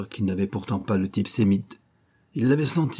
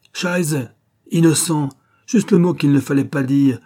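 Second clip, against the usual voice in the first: raised, punchy exasperation; angry outburst; 6 semitones higher, 6 dB louder than usual. Acted. Officer furious at the word innocent, exasperated. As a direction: curse and pounce on the offending word.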